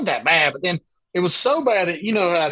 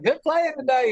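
imitating Kenny; 10 semitones higher than usual. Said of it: "he's like".

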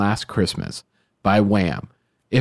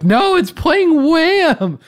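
coworkers' outrage; much slower than usual. Retold or imitated. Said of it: "And they're like".

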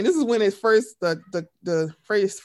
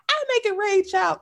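high, sassy imitation of Cynthia; mock Cynthia boast; 11 semitones higher than usual. Podcast host imitating Cynthia bragging, high and sassy. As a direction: brag about the money like Cynthia. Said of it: "She's like".

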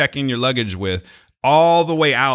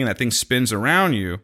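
ordinary talk

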